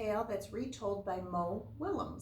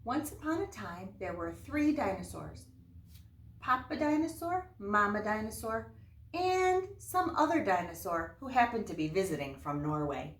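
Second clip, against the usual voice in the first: bright, louder read-aloud storyteller voice; story narration; 3 semitones higher, 7 dB louder than usual. Acted. Teacher reading a fairy tale to her class, lively storyteller voice.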